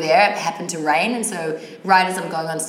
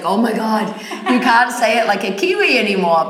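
higher, louder, laughing protest; laughing friend's tease; 5 semitones higher, 6 dB louder than usual. Retold or imitated. Best friend teasing her American accent, laughing. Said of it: "she's like".

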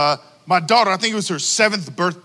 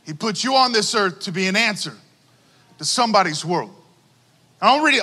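ordinary delivery

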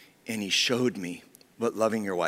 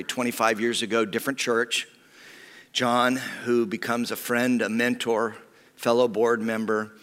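ordinary delivery

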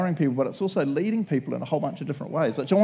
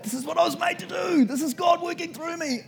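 overwhelmed joy. Retold or imitated. Preacher mid-sermon overwhelmed, ecstatic shout. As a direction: cry it out, overcome with purpose. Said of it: "I just felt so".

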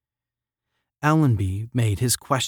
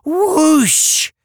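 very high, loud whoosh; long, high hooted whoosh through pursed lips; 8 dB louder than usual. A narrator's reading. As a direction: alien imitating a rushing blast. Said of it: "pursed his monkey lips and said".

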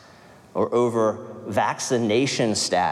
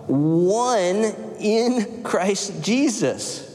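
slow emphatic; 6 semitones higher, much slower than usual. Real, not acted. Congregation completes the verse.

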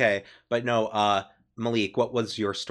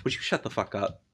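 softer, flat-toned deadpan; a deadpan brush-off; dry sardonic; 3 semitones lower, 4 dB quieter than usual. Retold or imitated. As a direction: shut them down dryly. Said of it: "dryly and sardonically".

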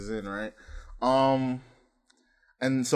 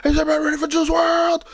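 high, loud concert shouting; mock a hype man building to a scream; imitating hype man; 4 dB louder, faster than usual.

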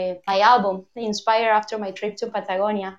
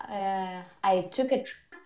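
bad connection; 7 dB quieter than usual. Real, not acted. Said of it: "You're breaking up".

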